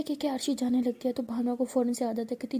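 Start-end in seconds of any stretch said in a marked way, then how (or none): none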